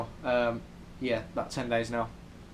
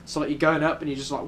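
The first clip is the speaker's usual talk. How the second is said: ordinary talk, nothing marked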